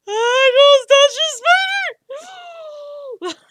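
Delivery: loud, high, drawn-out mock shriek; mock freak-out. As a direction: freak out at full volume, hammed up. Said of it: "I will freak out".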